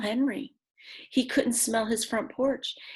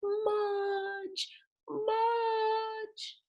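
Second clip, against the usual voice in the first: high, soft calling out of the name; distant calling; 14 semitones higher, 6 dB quieter than usual. Acted. Boy calling his lost dog across the field, high and soft. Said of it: "calling and calling".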